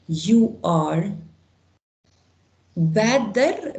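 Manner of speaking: ordinary talk, nothing marked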